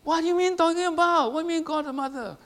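high, quick, incredulous pushback; indignant retort. Acted. A believer challenges a stranger on the train, high, quick and incredulous. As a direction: fire back like you can't believe it. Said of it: "How are you gonna say".

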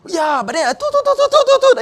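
high, loud do-do-do bleeping with a laugh; laughing high censor beep; mock beep. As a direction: laugh while bleeping out her words. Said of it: "And then".

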